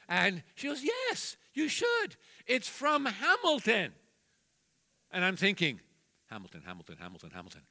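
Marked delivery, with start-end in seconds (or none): 0.8-3.9 put-on much higher teen voice; lightly voiced granddaughter. 6.3-7.6 much lower, much softer muttering; low murmured pondering; puzzled muttering. Retold or imitated. Grandpa thinking aloud, puzzled.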